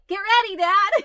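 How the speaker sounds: high, loud, giggly call to Dad; laughing warning shout. Retold or imitated. Daughter shouting a warning to her dad at the bar, high and loud. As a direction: yell it out through laughter. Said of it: "I was like".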